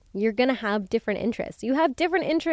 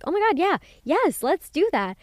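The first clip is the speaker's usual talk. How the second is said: much higher, eager agreement; mock eager yes; 8 semitones higher, 4 dB louder than usual. Friends answer the dinner idea with high eager excitement. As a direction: gush like you've been waiting for this.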